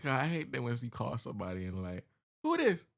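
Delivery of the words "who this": high-pitched, loud mimicry; mock demanding. Mimicking someone you called who asks who it is, mock demanding.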